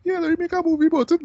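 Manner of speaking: very high, quick mock quote; mock-casual pitch, voice shot way up; mocking imitation. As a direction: toss it off in a squeaky-high mocking voice. Mocking the film makers' casual pitch.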